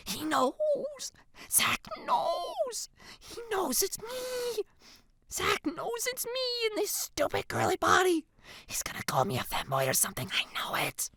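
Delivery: high, whimpering hushed panic; panicked whisper. A narrator's reading. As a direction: whisper in mounting panic, near tears. Panicking, hiding in her seat.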